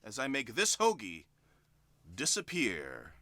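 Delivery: stage magician drawing it out; dramatic magician reveal. Acted. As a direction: drop low and slow on disappear. Magician announcing a trick.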